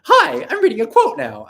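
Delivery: high, loud, drawn-out nasal greeting; hammed-up nasal chirp, slow and high. Hopeful collaborator popping into someone's video to read a quote, hammed-up nasal voice.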